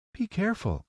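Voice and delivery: put-on very high girlish voice; green girl. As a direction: warn in a high-pitched girl's voice. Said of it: "cried the green girl".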